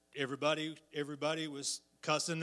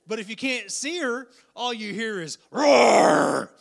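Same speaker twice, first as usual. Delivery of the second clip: preacher's rising, slowed cry; building preacher's shout; 6 semitones higher, 13 dB louder than usual. Acted. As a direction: voice climbs higher and louder into "roar". Preaching climax about the roar.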